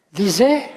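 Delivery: loud "Disait" with a lift on "-sait", then stop; rhythmic group. Shown as a demonstration. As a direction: land hard on the last syllable of "Disait". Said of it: "Là, c'est deux". (« Disait » scandé, accent fort sur « -sait »)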